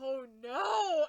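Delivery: loud, drawn-out mock wail; hammed-up heartbreak; dismayed wail. Grieving reaction to the line.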